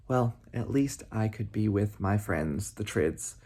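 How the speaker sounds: soft low resigned murmur; resigned sad thought. Acted. Rabbi alone, resigned to join his friends, soft. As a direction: quietly give up, alone.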